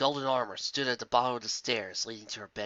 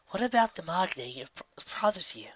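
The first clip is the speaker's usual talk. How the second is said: gentle probing princess; 6 semitones higher than usual. Acted.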